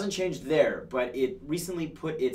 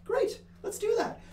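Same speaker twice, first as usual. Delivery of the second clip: much higher sing-song "Great"; mock enthusiasm; slower than usual. Host mockingly cheering a party policy. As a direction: chirp it up high, half sarcastic. Mock praise of a platform point.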